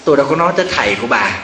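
quick, laughing tease; husband teasing with a laugh. Retold or imitated. Husband teasing his wife, fast and laughing. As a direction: rattle it off through a chuckle, mocking the wife. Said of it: "mắc cười lắm, ông nói".

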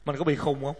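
a hushed, rueful question to oneself; quiet self-questioning. Retold or imitated. Monk asking himself why he got angry, hushed. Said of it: "Mình kêu".

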